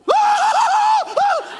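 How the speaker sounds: very high, loud shrieking scream; startled scream. Retold or imitated. Pastor shows his scream at meeting his wife in the hallway, piercing and high. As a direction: shriek like you've been jumped in the dark. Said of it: "That's my response".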